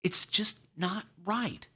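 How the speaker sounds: put-on high-pitched, pleading child's voice; anxious young boy. A narrator's reading. Boy explaining himself to his uncle, anxious child voice. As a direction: plead to be understood, like an upset boy. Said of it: "looked at Uncle Al anxiously".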